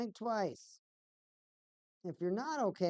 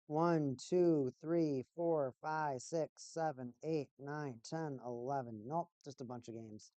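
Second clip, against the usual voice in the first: ordinary delivery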